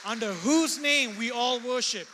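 preaching with rising force; full-voiced pulpit proclamation; fervent preaching. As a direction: preach it out to the congregation.

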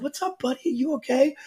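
high, gentle parent voice; re-enacted tender check-in; concerned dad. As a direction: soothe an upset little boy. Dad soothing his crying son.

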